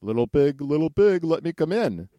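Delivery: high, loud wolf call. Acted. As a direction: call through the door like a wolf. Wolf demands entry.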